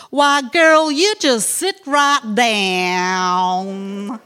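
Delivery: broad southern US hostess, loud then very slow; a southern US host waving a guest to sit; southern US accent. Retold or imitated. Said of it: "her down south was even more down south".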